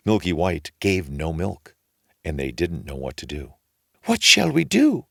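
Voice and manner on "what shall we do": high, fretful wailing voice; desperate worried mother; anxious widow. A narrator's reading. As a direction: wring your hands and fret aloud. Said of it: "said the widow, wringing her hands".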